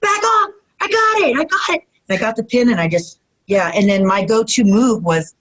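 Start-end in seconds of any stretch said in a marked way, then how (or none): 0.0-1.8 high, strained insistence; insisting. Retold or imitated. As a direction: push her off, high and pressed.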